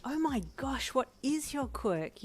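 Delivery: high, slow, thrilled; asking a friend about his quirk, high and excited. A narrator's reading. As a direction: gush with curiosity. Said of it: "you asked Brian excitedly".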